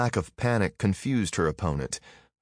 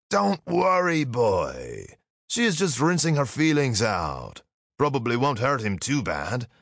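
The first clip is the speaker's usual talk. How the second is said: amused Gaul; 5 semitones higher than usual. A narrator's reading. Gaul reassures boy.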